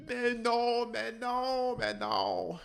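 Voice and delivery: put-on high, French-accented "Mais non" wailed; mock French whine. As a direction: whine it like a sulking French player. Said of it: "they're just like".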